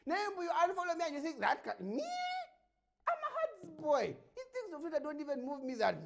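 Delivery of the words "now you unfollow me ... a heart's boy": loud, high defiant boast; 1 defiant shout. Real, not acted. Host boasting to the follower who unfollowed him, loud. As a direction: shout it out proudly.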